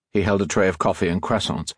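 ordinary delivery, nothing marked

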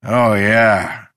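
put-on drawn-out drunken groan. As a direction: groan it out, loud and long. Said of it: "he groaned".

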